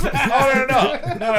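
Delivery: high, loud laughing no; laughing protest. Real, not acted. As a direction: laugh while refusing. Laughing refusal.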